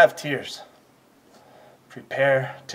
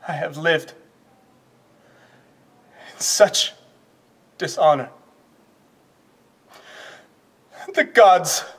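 broken up by long pauses; halting, shame-laden stage lament; anguished lament; 9 semitones higher, 7 dB louder than usual. Acted. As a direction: let each phrase struggle out. Acted lament.

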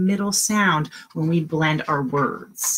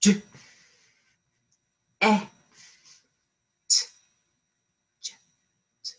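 slow sounding out; much slower than usual. Shown as a demonstration. Teacher sounding out jet slowly for the class. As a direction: slowly split J, eh, t, then blend into jet. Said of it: "I wanna hear you".

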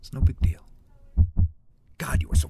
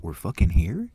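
ordinary talk, nothing marked

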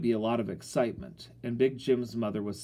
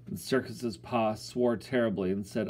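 ordinary delivery, nothing marked

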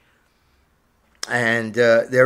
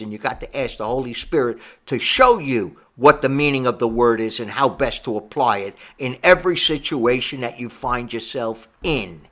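ordinary talk

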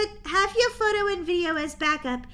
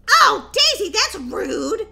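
offended cry; 5 semitones higher, 8 dB louder, slower than usual. Acted. Hurt by insult.

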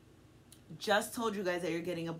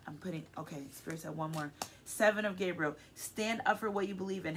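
usual speaking voice

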